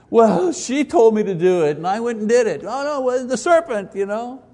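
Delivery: high, loud excuse-making; whiny Adam. Retold or imitated. Adam blaming Eve and the serpent, high and whiny. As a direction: blame everyone else, whining. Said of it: "Adam excusing himself".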